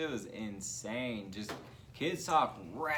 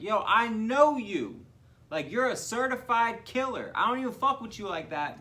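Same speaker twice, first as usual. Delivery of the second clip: hammed-up, raised-voice astonishment; mock incredulous confrontation; 9 semitones higher, 7 dB louder than usual. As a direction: imitate a man stunned at who he sees. Acting out incredulous man confronting killer.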